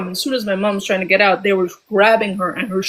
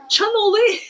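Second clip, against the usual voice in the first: higher mock exclamation of mom's word; mimicking mom; 13 semitones higher than usual. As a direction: toss it off like her stunned mom. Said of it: "I like her exact word".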